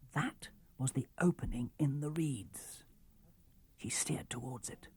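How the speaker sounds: whispered, unvoiced narration; hushed storyteller whisper. A narrator's reading. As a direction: whisper it, sneaking up. Stealthy approach, narrator whispers.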